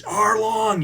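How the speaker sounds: a mock furious cry; angry call. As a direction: call him out as she storms in. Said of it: "and she's".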